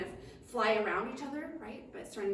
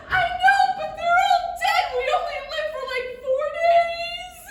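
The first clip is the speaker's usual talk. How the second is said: wailing dragonfly; 11 dB louder than usual. Acted. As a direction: bawl it out dramatically. Dragonfly wails about dead ancestors.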